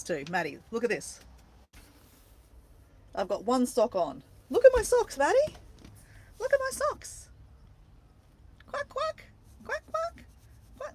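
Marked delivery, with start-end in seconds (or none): 4.5-7.3 very high, squeaky duck-sock voice; talking duck. Pretend. Duck socks show themselves off to Maddie, talking duck voice. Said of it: "my ducks are talking to you". 8.7-10.9 tiny, very high pretend quacks; quacking duck. Duck socks chatting to viewers, high duck quacks. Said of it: "my ducks are talking to you".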